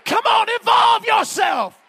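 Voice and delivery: high, loud shouted exhortation; preaching at full force. Acted. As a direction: rouse the crowd, shout it out. Preacher urges the congregation.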